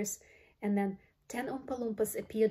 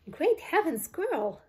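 high, loud cry of shock; appalled high exclamation; screech; 8 semitones higher, 5 dB louder, slower than usual. A narrator's reading. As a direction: screech in disbelief. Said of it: "screeched Missus Beauregard".

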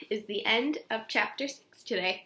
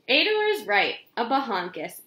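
ordinary delivery, nothing marked

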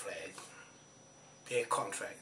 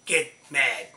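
tough; 9 dB louder than usual. Real, not acted. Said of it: "You wanna talk tough?".

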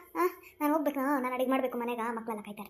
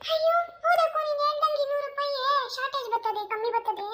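higher fretful woman counting money; worried village woman; 9 semitones higher than usual. Acted. Village woman telling the group money is short, fretful and higher. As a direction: fret over the missing cash, pitched up.

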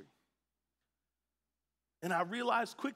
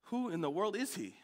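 muttered aside; 3 semitones lower, 7 dB quieter than usual. Retold or imitated. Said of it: "and I'm like".

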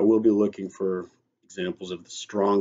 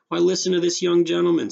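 raised, high-pitched indignant cry; louder and much higher, indignantly calling her attention; exasperated outburst; 11 semitones higher, 6 dB louder, faster than usual. A narrator's reading. Accused man points out the boy to his mother, exasperated.

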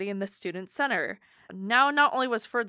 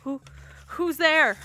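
scared stammering call, rising loud; frightened, stammering whimper; 5 semitones higher, 4 dB louder than usual. Acted. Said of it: "Ferd whimpered".

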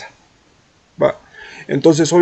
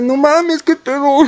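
high, incredulous cry; stunned disbelief; 9 semitones higher, slower than usual. Retold or imitated. Telling viewers how shocked he was at her reply, high disbelief. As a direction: sound floored by what she just said. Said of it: "Y yo así de".

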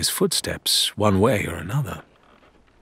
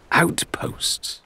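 breathy whisper of surprise; whispered question; 8 semitones higher than usual. A narrator's reading. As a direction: muse it under your breath. Narrator's musing question.

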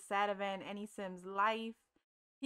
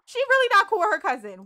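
louder and quicker, REALLY pushed hard; louder, faster repeat; 8 semitones higher, 10 dB louder, faster than usual. Real, not acted. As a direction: say it again, louder and exasperated. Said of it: "repeated louder".

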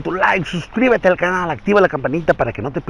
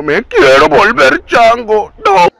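higher, louder cartoon dad voice; Homer Simpson voice; 9 semitones higher, 4 dB louder than usual. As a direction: whine it like Homer. Homer catchphrase teaser.

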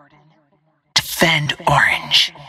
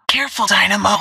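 high-pitched heads-up; urgent warning; 7 semitones higher, faster than usual. Acted. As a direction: warn a teammate of sudden danger. Game warning to a teammate.

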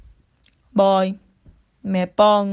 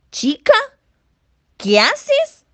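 higher, louder startled question; startled scolding; 12 semitones higher, 6 dB louder, slower than usual. Retold or imitated. Mother from the kitchen to her daughter, loud and startled. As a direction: call out across the kitchen in surprise.

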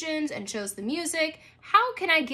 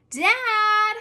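higher, louder call up the stairs; girl calling up the stairs for her father; 9 semitones higher, 7 dB louder than usual. Acted. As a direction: call for her dad through the house. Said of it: "she called".

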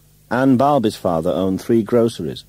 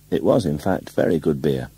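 ordinary delivery

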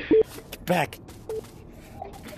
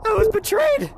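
indignant cry; 13 semitones higher, 3 dB louder than usual. Real, not acted. Player protests being betrayed.

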